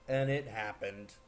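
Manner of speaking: ordinary talk, nothing marked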